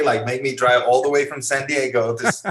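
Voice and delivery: retold goading. Photographer challenges young skater over the drive, goading. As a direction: needle the kid, as retold. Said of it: "him fucking with your head".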